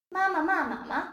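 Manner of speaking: "ma ma ma ma ma" moving through high, falling, dipping and light tones; Mandarin tone demonstration. Shown as a demonstration. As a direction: run the five "ma" syllables together, each with its own tone. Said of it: "and they're pronounced".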